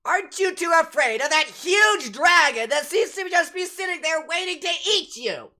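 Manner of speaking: high, loud incredulous character voice; game character's alarmed challenge. Acted. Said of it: "looks at you guys".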